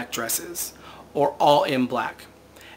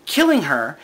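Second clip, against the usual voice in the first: ordinary talk, nothing marked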